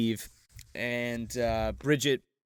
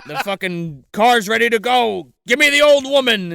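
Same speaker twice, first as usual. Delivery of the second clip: loud mock-furious demand, rising higher; unhinged aunt; 6 semitones higher, 7 dB louder than usual. Aunt demands grandma for the car, loud unhinged mock yell. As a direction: holler it like someone losing it. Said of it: "Ruth has her schizophrenic moment".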